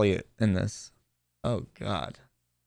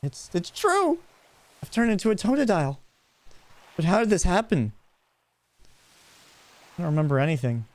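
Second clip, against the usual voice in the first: higher, fast, shocked newcomer; startled hero voice; 7 semitones higher, faster than usual. Acted. Hero realises he turned into a Totodile, higher fast shocked voice. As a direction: sell the shock of waking up changed. Voices protagonist reading dialogue.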